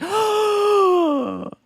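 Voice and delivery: sharp delighted gasp; a big happy intake of breath; excited gasp. Retold or imitated. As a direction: gasp with joy at the message. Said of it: "I'd be like".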